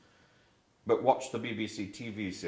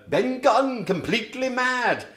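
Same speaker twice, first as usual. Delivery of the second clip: lightly voiced Ben Gunn; 11 semitones higher, 6 dB louder, slower than usual. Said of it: "Treasure Island".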